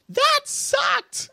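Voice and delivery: high-pitched outburst from an audience member; yelling. Retold or imitated. Girl in the front after the screening, yelling. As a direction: yell it out like an angry moviegoer. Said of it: "she just yells it".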